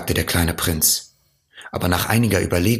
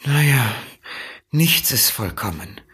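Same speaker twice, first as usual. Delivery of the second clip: sighing; 6 semitones higher than usual. A narrator's reading. Fox gives up on the prince's planet, sighing. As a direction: sigh it out as the let-down fox. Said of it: "seufzte der Fuchs".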